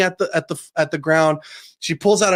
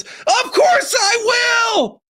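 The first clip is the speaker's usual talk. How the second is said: much higher, drawn-out mock yell; hammed-up shout of Luffy's promise; imitated heavenward shout; much slower than usual. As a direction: belt it out slow like an anime hero's cry. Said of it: "he shouts to the fucking heavens".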